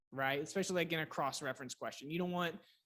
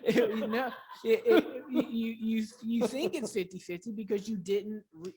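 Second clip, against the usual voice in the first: loud, high, laughing through the words; high laughing disbelief, words tripping; laughing retort; 6 semitones higher, 8 dB louder than usual. Real, not acted. Laughing while retelling his retort.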